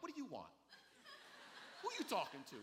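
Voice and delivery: lightly played street challenge; New Yorker attitude. New Yorker answering a friendly greeting on the street, mock brusque. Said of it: "you become a New Yorker".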